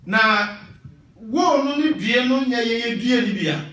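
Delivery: normal talking voice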